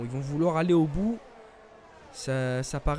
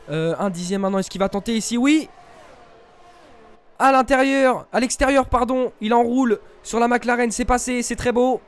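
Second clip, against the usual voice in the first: loud, high call of a pass; excited shouting commentator; 7 semitones higher, 10 dB louder than usual. Real, not acted. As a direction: yell the overtake as it happens. Overtake called live.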